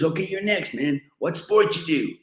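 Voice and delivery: a pushy photo organiser calling out; American organiser. Retold or imitated.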